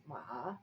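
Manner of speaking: "ma" low, dipping then rising; third tone. Shown as a demonstration. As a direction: sink "ma" down and bring it up. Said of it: "that's third tone".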